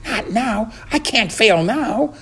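tiny, very high mouse voice; high-pitched, urgent little thought; desperate mouse. A narrator's reading. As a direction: plead with yourself in a high squeak. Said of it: "thought Ralph".

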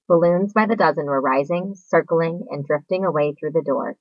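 usual speaking voice

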